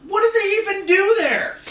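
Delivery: high-pitched comic disbelief; incredulous outburst. Acted. Friend griping about losing to Finland, incredulous.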